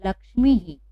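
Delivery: "लक्ष्मी ही" with the visarga sounded as ही; loud, clear teaching of "लक्ष्मी ही"; visarga demonstration. Shown as a demonstration. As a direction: echo the long ई after ह in "लक्ष्मी ही". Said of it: "ईकार हो जाएगा".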